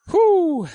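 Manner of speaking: high, loud strained whoo pushed out mid-rep; effortful whoop. Real, not acted. Lifter straining through a heavy rep, loud high whoop. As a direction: let out a real effort whoop on the pull.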